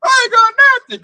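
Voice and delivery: high wailing mock sob; mock crying. Ruined rich man crying at home, a high mock wail. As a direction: wail like you lost all your money. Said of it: "You gonna hear crying".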